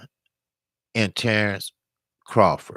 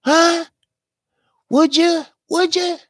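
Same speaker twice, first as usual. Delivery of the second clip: squeaky, much higher mocking challenge; mock mad taunt. Retelling a rival's angry challenge, high-pitched mocking taunt. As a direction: imitate a fighter getting mad, pitched way up. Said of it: "Then he try to get mad".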